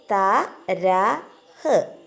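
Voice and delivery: തരഹ് drawn out very slowly, final ഹ് breathed; model reading. Shown as a demonstration. Teacher models തരഹ് for children, very slow and drawn out. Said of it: "ഇത് ചേരുമ്പോൾ എന്ത് വായിക്കും?".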